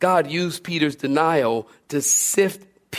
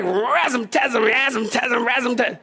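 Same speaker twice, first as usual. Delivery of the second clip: high, garbled cartoon swearing; hammed-up gibberish cussing; mock cursing; 5 semitones higher than usual. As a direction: sputter nonsense curse words as a riled fisherman. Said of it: "Peter starts cursing the girl out".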